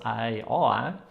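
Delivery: vowel-only Northern Norwegian sentence; Northern Norwegian dialect. Shown as a demonstration. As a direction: speak it as Northern Norwegian, vowels running on without consonants. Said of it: "this is firstly Northern Norwegian dialect".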